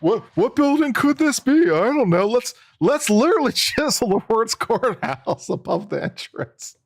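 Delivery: high, put-on dopey voice, slowing at the end; mocking the builders. Mocking the courthouse builders who need a label, in a high dopey voice. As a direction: play a clueless builder puzzling over the building. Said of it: "They needed the clarification".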